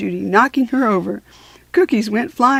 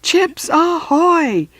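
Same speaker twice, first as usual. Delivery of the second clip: drawn-out call; 9 semitones higher, 5 dB louder, much slower than usual. Acted.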